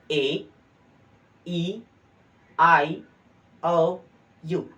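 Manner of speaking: loud, isolated "ए, ई, आई, ओ, यू", a pause after each; throaty vowel demonstration. Shown as a demonstration. Teacher shows sound coming from throat, "ए, ई, आई, ओ, यू" called out singly. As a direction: sound "ए, ई, आई, ओ, यू" from the throat, high and separate. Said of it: "ऐसा लग रहा है यहाँ से".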